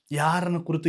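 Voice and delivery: ordinary talk, nothing marked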